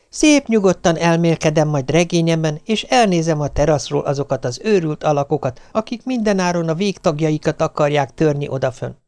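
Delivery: plain reading voice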